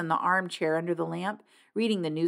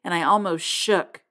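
plain reading voice

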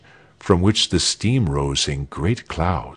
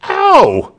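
high, loud "ow"; sudden burnt-finger cry; yelp of pain; 9 dB louder than usual. A narrator's reading. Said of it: "cried Arnold".

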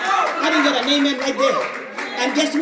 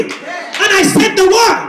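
loud, raised, driving declarations; full-force preaching; 6 semitones higher, 7 dB louder than usual. Real, not acted. Preacher casting out sickness from the office, shouting at full force. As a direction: hammer each phrase as a commanding shout.

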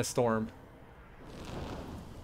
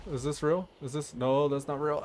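ordinary delivery

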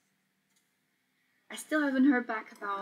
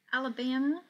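higher, louder mock American accent; attempted American accent; 4 semitones higher, 6 dB louder than usual. Streamer reads a US state from chat, attempted American accent. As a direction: have a poor go at an American accent. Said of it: "why I said it in that voice".

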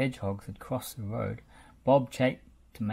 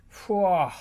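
loud, higher cat yowl; cross yowl; 6 semitones higher, 6 dB louder than usual. A narrator's reading. Pilchard yowls at Lofty, cross. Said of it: "she yowled crossly".

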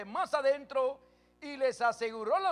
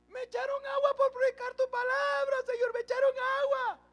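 high, wailing mock sob to the Lord; hammed-up whimpering plea; imitating his own crying; 5 dB louder than usual. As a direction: replay the tearful complaint, voice pitched way up and louder. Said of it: "Yo una vez estaba llorando de tristeza".

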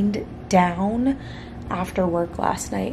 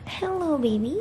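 cat-talk voice; 8 semitones higher, 3 dB quieter than usual. Real, not acted. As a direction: coo hello to the pet. Greets her cat.

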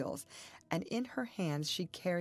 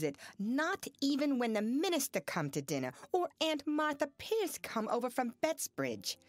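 bitter accusation; 6 semitones higher than usual. A narrator's reading. Zena mocking then raging.

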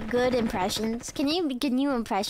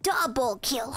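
ordinary talk

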